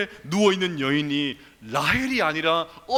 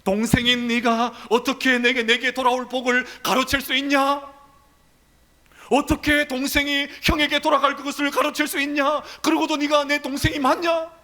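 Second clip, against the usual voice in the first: higher, louder accusing brother; angry brother; 6 semitones higher, 3 dB louder than usual. Acted. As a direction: demand answers from the brother who stole. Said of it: "형인 에서의 목소리도 들리는 것 같습니다".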